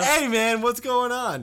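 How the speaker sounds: excited greeting. Pretend. Mock greeting to listeners.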